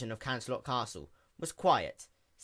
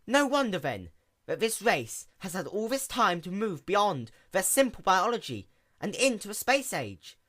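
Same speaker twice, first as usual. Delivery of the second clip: a hint of an eager, higher young pony; quick, bright-eyed little mechanic pony; lightly voiced sea pony; 8 semitones higher, 5 dB louder than usual. A narrator's reading. Sea pony introduces herself to the princess in a lightly raised voice.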